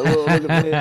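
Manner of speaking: imitated giggly showing off to friends; giddy laughing. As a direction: act out being high on laughing gas. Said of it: "laughing, like, Snapchatting my homies".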